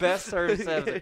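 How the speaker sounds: laughing through the words. Real, not acted. Friend laughing at the drugged waiter story, laughing.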